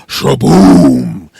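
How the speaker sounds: loud, drawn-out impact noise; big vocal crash effect; booming crash imitation. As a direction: boom out the pothole hit. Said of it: "potholes where it goes".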